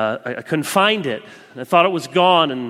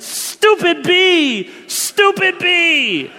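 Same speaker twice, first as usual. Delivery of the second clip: much higher and louder, slow furious mock yell; angry stomping; 9 dB louder, much slower than usual. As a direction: stamp out each word at the bee. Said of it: "I was so angry".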